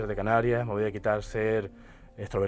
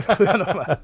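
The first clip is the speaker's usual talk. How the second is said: laughing; 7 semitones higher, 8 dB louder than usual. Real, not acted. Repeating the answer to the host's cheeky question, laughing. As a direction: repeat it through real laughter, pitched up and louder.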